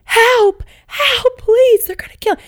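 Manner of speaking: high, loud imitated scream; acted-out cry for help, loud and very high-pitched; mother screaming for help. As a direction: mimic a panicked plea at the top of your range. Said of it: "my mom's voice, screaming".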